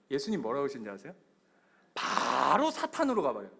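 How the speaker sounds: normal talking voice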